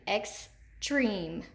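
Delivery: "Extreme" split into ex, a pause, then treme; teacher sounding out "Extreme" with a gap before the long E syllable. Shown as a demonstration. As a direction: say "Extreme" in two separate beats. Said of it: "Where do we hear that accented long E?".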